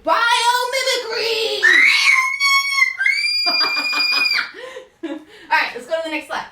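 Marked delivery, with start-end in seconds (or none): 0.0-1.6 slow, high call to the room; drawn-out teacher's shout; loud, projected. Shown as a demonstration. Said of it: "so they can hear you". 1.7-5.0 gleeful piercing yell; high squeal. Real, not acted. Child yells it back, then laughter.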